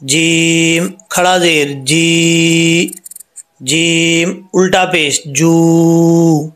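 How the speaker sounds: very slow, each syllable drawn out; slow recitation drill. Shown as a demonstration. Teacher drilling vowel marks with students, slow recitation. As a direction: spell it out slowly for the class. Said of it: "अब इनकी हरकत जो है वो सुने".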